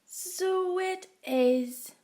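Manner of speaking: high, very slow yawning voice; drowsy yawned reply; sleepy yawn. A narrator's reading. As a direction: stretch it out like you're nodding off. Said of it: "yawned Missus Tortoise".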